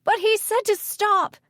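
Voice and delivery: high, loud wail of protest; frantic protest. A narrator's reading. Woman argues back at the mayor, frantic protest. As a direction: shout it high and desperate.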